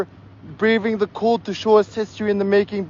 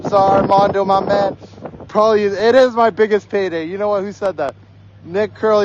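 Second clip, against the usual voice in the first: usual speaking voice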